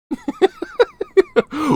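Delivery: high-pitched laughing fit; helpless high cackling laughter. Real, not acted. Cracking up at his joke.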